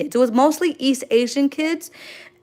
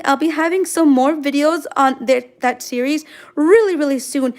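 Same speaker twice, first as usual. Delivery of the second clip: normal talking voice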